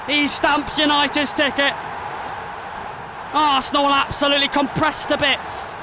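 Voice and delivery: excited play-by-play, rising with each penalty; live commentary. Acted. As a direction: call the shootout like a TV commentator.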